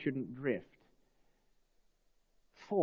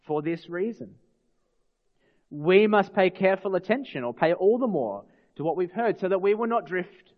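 ordinary talk

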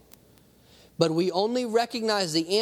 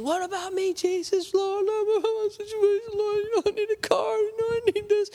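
very high, whining plea; high nasal-free whimpering complaint to the Lord; whiny griping believer.

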